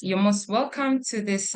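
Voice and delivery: ordinary talk, nothing marked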